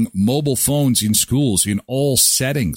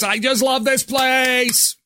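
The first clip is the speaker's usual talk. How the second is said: over-the-top high-pitched fan voice; obnoxious loud talker; 11 semitones higher than usual. Retold or imitated. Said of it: "constantly talking like this".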